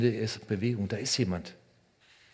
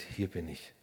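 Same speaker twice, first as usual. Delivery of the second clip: tender; 4 semitones lower, 8 dB quieter than usual. Acted. Jesus spricht ganz nah.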